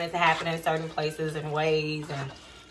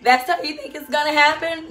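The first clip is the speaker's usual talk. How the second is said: high, amused mock disbelief; lightly voiced God, teasing; a hint of God chuckling at your plans; 9 semitones higher, 6 dB louder than usual. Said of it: "God's laughing like".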